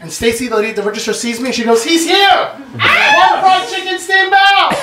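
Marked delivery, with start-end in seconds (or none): 1.8-4.8 high, slow call across the shop; calling out an order. Retold or imitated. Cashier calling to the kitchen, high slow shout. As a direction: announce him like a regular, high and drawn out.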